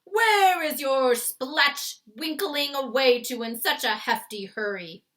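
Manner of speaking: loud, slow, booming giant jeer; bully giant sneering, loud; taunting giant. A narrator's reading. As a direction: roar the mockery down at him. Flesh lump eater taunting.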